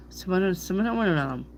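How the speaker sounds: cinnamon babbled with extra syllables; mangled cinnamon. Retold or imitated. Reader mocking how some people say it, mangled cinnamon. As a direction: let "cinnamon" run away into "cinnamona-nanalum". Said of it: "Some people say cinnamon".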